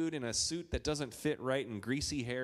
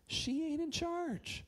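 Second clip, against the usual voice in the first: high, slow "she ain't in charge"; dad telling son, slowly and high; emphatic sing-song; slower than usual. Retold or imitated. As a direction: draw it out high and sassy.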